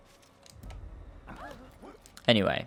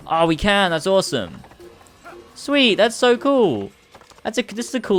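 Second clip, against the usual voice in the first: high, loud gleeful exclamations; thrilled squeal of approval; delighted; 9 semitones higher, 4 dB louder than usual. Real, not acted. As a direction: burst out in high-pitched delight. Delighted at crossing the bridge.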